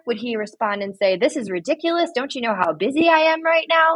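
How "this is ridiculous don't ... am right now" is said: high-pitched, put-on indignation; mock exasperation. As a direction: scold like someone too busy to be bothered. Second imagined scolding response.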